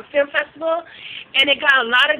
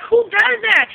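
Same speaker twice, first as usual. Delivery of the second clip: disgusted disbelief; 8 semitones higher than usual. Retold or imitated. Vlogger reacting to a gross video, high disgusted disbelief. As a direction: throw it out in sheer disgusted disbelief. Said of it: "I was like".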